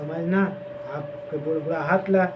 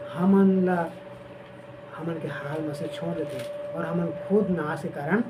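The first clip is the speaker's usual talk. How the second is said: ordinary delivery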